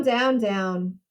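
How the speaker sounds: plain reading voice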